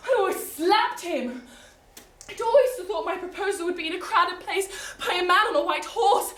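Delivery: ordinary talk, nothing marked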